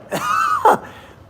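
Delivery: high wild laughing burst; shrieking laugh. Real, not acted. Host cracking up on stream, high shrieking laugh. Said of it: "Cool name".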